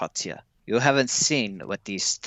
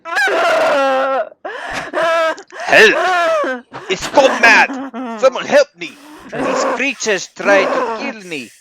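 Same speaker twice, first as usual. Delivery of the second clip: high, loud terrified shrieks for help; desperate shouting, much higher and louder; panicked screaming for help; 10 dB louder than usual. Acted. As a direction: scream for your life as the creature attacks. Attacked man screams for help.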